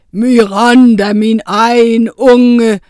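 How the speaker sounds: langsomt, meget højt dragebrøl; brøl det som torden; brølende drage. A narrator's reading. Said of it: "brølede Oscar". (tordnende, langtrukken dragestemme)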